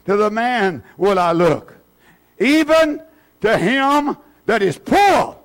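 slow, declaimed verse with long pauses; emphatic scripture reading. Real, not acted. Preacher reading Isaiah to the congregation, emphatic scripture reading. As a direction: build to a high, ringing proclamation.

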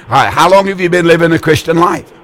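ordinary talk

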